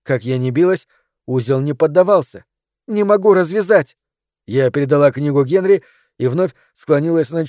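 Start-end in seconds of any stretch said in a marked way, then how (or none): 2.9-4.0 higher-pitched, urgent cry; frantic frustration. A narrator's reading. Narrator as heroine fighting a stuck knot, frantic. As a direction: exclaim it, desperate to get the knot undone.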